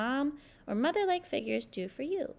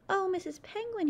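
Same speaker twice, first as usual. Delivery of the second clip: crying out; 7 semitones higher than usual. Acted. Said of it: "he cried".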